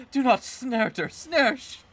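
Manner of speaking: high, loud, slow comic plea; mock plea. Begging the Pokemon not to snort, a loud high squeal. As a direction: beg in an exaggerated squeal.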